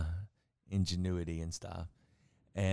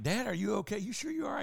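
put-on much higher, anxious young son; worried son; 12 semitones higher, 4 dB quieter, faster than usual. Son finding his father by the fire, worried and high. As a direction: check on dad, voice way up. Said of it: "And he's like".

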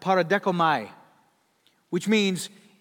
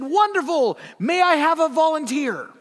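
much higher and louder, a sly showman; spring the trap with a grin; showman calling out; 9 semitones higher, 8 dB louder than usual. Retold or imitated. Blondin asking the crowd for a volunteer, booming showman.

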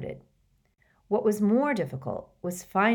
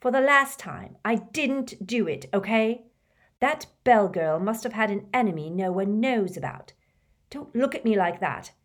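higher and louder, resentful protest; defiant sullen teen; 4 semitones higher, 4 dB louder than usual. Acted. Malfoy defies Snape in the classroom, higher and louder. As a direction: insist you didn't do it, on the edge of shouting.

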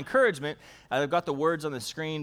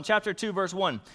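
ordinary delivery

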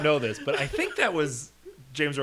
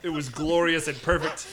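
usual speaking voice

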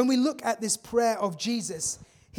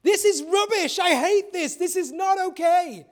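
loud high frustrated outburst; acted-out yell of despair; 9 semitones higher, 8 dB louder than usual. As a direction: yell it out like venting at the world. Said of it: "they're just yelling".

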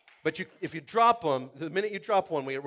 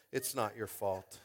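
soft, low and slow reassurance; slow, hushed comfort; tender consoling; 6 semitones lower, 7 dB quieter, slower than usual. Pretend. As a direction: ease the child's guilt gently. Comforting kid who dropped baby.

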